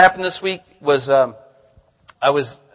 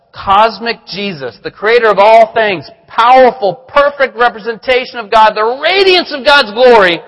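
rising praise; 4 semitones higher, 4 dB louder than usual. Real, not acted. Building praise of Christ.

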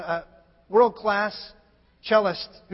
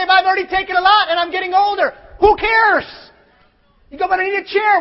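high louder whiny excuse; complaining old-timer; 8 semitones higher, 7 dB louder than usual. Pretend. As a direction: whine like a tired old man. Voicing an aging doubter.

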